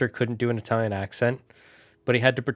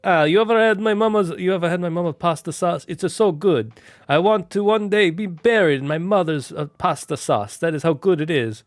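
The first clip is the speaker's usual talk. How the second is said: high, loud, hammed-up Italian accent; gushing mock Italian, pitched up and loud; really bad Italian accent; 6 semitones higher, 5 dB louder than usual. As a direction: badly fake an Italian mafia guard. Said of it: "he's doing this really bad Italian accent".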